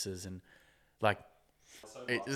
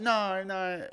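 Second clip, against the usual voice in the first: high-pitched skit protest; mock refusal; 10 semitones higher than usual. Character turns down the five-pound dare, mock refusal.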